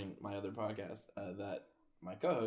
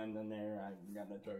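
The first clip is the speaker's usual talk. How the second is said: Texas Southern accent; 8 dB quieter than usual. Shown as a demonstration. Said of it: "It's like some Texas South".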